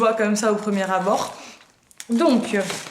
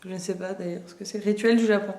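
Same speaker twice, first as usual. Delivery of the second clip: usual speaking voice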